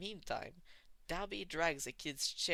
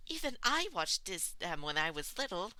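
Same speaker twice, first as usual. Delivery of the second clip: put-on chirpy girl voice; 5 semitones higher, 5 dB louder than usual. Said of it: "Toga chimes in".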